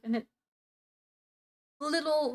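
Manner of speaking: ordinary talk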